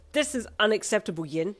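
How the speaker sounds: sharp loud angry bark; a father snapping with fury. Acted. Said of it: "your dad barked".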